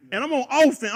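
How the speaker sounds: usual speaking voice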